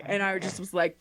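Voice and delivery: ordinary talk, nothing marked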